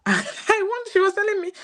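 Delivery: breaks into a high laugh while speaking; words bubbling through real laughter. Real, not acted. Mother laughing while retelling her daughter's words.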